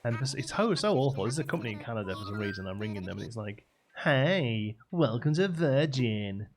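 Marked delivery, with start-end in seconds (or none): 4.0-6.5 slow, loud, over-chirpy automated greeting; mock a trendy phone-line presenter, drawn out; advert voice. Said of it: "sort of voice that would do an advert".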